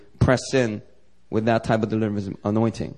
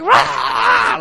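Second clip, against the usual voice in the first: a sudden high, loud possessed roar; demonic roar; 8 dB louder than usual. Retold or imitated. Possessed woman on the video roars out. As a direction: let out one wild demonic shriek-roar. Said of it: "like, demonically possessed".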